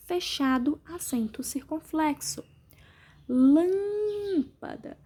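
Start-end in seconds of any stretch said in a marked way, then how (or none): 3.3-5.0 "Lâmpada" with LÂM very long and high; stress on LÂM. Shown as a demonstration. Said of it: "Sílaba mais forte é o lã".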